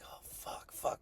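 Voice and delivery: whispered curses under breath; whispered panic. Retold or imitated. Student sitting in principal's office, whispered panic. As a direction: whisper it in dread.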